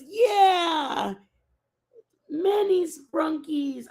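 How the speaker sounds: put-on high, loud, slow excited little kid shout; imitating toddler. Mom imitating her toddler's excited shout. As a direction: squeal it like a thrilled three-year-old.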